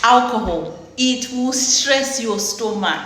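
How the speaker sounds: put-on slow, emphatic Nigerian accent. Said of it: "in my Nigerian accent".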